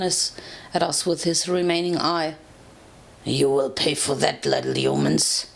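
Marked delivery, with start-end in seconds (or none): 3.2-5.5 deep, menacing dragon growl. A narrator's reading. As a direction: growl it low like a huge wounded dragon. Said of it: "he growled".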